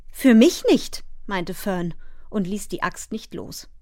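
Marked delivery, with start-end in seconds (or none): 0.1-1.0 high, louder retort with a rise; defiant child. A narrator's reading. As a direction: contradict stubbornly, voice pitched up. Fern defiantly contradicts father. (trotzig, mit hoher Stimme)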